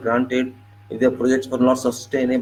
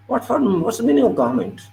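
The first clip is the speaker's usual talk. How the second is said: indignant outburst; 10 semitones higher, 3 dB louder, faster than usual. Real, not acted.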